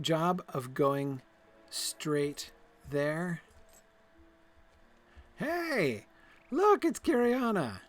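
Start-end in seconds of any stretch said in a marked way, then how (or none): 5.4-7.8 high, excited hello to a friend; bright greeting. Acted. Greeting Kiriana in the game, high and cheery. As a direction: call out happily on spotting her.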